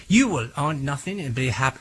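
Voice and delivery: put-on a flat, half-hearted stab at a German accent; a German accent that falls flat; failed German accent. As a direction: try a German accent and barely manage it. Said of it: "I can't do a German accent".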